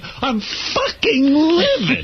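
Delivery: high, drawn-out livid outburst. Retold or imitated. Radio guest reliving his rage at Gayle King's entrance, livid. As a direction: hammer out each word, high-pitched and incensed. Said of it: "This is how angry you were".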